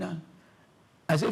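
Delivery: usual speaking voice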